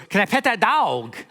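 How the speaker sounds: put-on high little-girl Southern twang; little girl's Southern drawl. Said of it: "And she goes".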